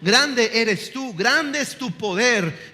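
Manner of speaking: high, loud, fervent praise; praise prayer. Shown as a demonstration. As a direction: lift your voice in worship. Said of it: "Alabe su nombre".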